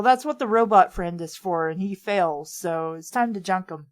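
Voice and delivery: ordinary talk, nothing marked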